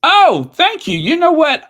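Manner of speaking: excited. Real, not acted. Excited reaction to viewer.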